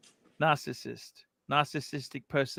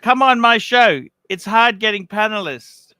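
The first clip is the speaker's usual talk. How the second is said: a hint of a whiny host, higher and louder; slightly raised mocking voice; mock pleading; 8 semitones higher, 10 dB louder than usual. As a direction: lightly mimic a begging host. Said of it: "he says to me".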